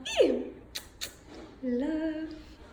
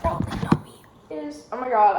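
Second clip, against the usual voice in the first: ordinary talk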